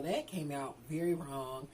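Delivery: ordinary talk, nothing marked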